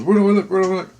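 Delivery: asking where to look, lightly mimicking him; eager asking.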